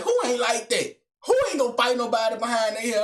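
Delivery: mock an affronted girl's quick retort; imitated defiant girl. Girl retorts to his doubt.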